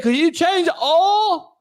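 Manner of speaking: high, drawn-out country holler imitation; exaggerated high-pitched country accent. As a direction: mimic a country man, stretching "oil" into "awl". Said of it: "I met a country motherfucker".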